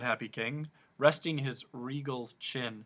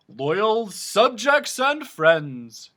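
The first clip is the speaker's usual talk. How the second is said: slow, loud, higher proclamation; echoing king speech; royal address; 8 semitones higher, 12 dB louder, much slower than usual. A narrator's reading. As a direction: address the empty hall grandly. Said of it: "his voice echoing".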